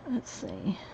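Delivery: whispered to herself; whispering. Real, not acted. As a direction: breathe it under your breath. Whispered while working.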